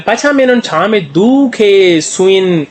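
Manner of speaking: पछा में उन छा में दू के सुइन को धीमे, एक-एक शब्द पर रुककर पढ़ें; कोरियन पंक्ति को अटक-अटककर ध्यान से पढ़ें; कोरियन वाक्य पढ़ना. Shown as a demonstration. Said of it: "यहाँ पे वो कुछ कह रहे हैं". (कोरियन वाक्य को धीरे-धीरे पढ़ना)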